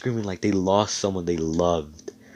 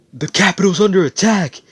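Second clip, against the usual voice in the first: much higher, much louder urgent yell; alarm shout; 12 semitones higher, 11 dB louder than usual. Acted. Mage bursts through the doors warning everyone, alarmed shout.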